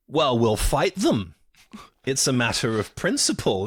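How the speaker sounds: mock bluster. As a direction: puff it up with mild mock defiance. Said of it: "blustery again".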